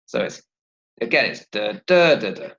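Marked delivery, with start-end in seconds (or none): 1.5-2.5 second "da" punched, the others lighter; da-DA-da-da, falling after the stressed "da"; stress pattern demo. Shown as a demonstration. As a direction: tap out the rhythm, hitting the second "da". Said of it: "it's actually the same pattern".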